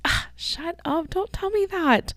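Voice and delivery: high, slow, squeaky whine; squealing mock protest. Podcaster telling her sister to hush, high and whiny. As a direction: whine it up high like you're embarrassed. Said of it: "And I was like".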